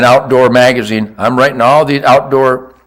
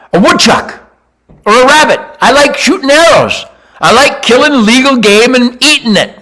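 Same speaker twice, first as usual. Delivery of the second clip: rising excitement, much higher and louder; excited; 9 semitones higher, 3 dB louder than usual. Real, not acted. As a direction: gush about loving the hunt. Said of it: "excited hunting rant".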